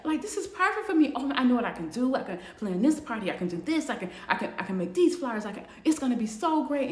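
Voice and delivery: tumbling over plans in excitement; excited planning. Retold or imitated. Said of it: "And she is so excited".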